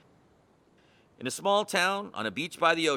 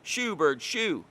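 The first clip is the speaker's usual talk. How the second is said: mild yell at the bird; shouting; 5 semitones higher, 4 dB louder, slower than usual. A narrator's reading. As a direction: shoo the gull away, calling out. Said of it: "chasing Gary and shouting".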